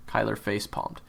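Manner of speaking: plain reading voice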